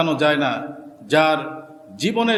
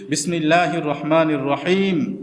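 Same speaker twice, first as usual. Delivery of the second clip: normal talking voice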